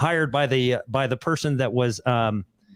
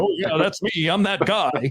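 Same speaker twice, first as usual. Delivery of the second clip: imitating a self-important boaster; mock arrogance; 3 semitones higher than usual. Man wrongly claiming divine standing, mocked arrogance. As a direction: put on a lightly cocky claim. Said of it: "this is the arrogance of it".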